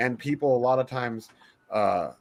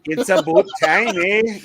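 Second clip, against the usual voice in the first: mock Canadian; 6 semitones higher, 7 dB louder than usual. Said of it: "you're Canadian now".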